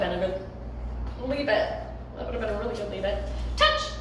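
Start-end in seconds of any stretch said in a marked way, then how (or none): 3.6-3.9 excited cue. Real, not acted. Cue to the dog.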